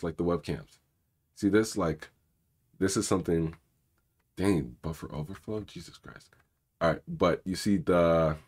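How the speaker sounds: ordinary delivery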